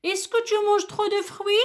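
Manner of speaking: "Est-ce que tu manges trop de fruits?" pitched high, voice climbing as a question; rising question tune. Shown as a demonstration. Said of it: "Regardez l'intonation que j'ai dit". (intonation montante de question)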